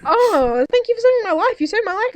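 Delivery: high, swooning mock gratitude; gushing rescued hero. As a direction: gush like a smitten damsel. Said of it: "And he was like".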